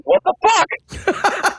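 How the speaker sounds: very high, slow, stretched-out gasp of disbelief; stunned disbelief. Retold or imitated. Fighters staring at him, very high drawn-out disbelief. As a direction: let it hang in shocked falsetto.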